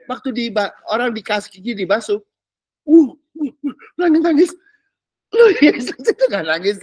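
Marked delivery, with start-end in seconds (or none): 2.9-4.5 mock crying. Mimics weeping churchgoers. 5.3-6.8 laughing through words. Real, not acted. Laughs mid-sentence.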